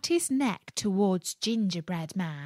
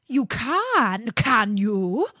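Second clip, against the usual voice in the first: snarling wolf, louder; feral snarling; 3 semitones higher, 6 dB louder, slower than usual. Acted. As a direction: snarl it at the cookie. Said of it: "snarled the wolf".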